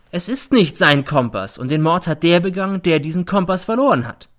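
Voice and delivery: loud, high gloating; triumphant. A narrator's reading. Mother to the judge, loud and triumphant. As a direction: announce it like a winning card. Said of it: "wendet sich triumphierend".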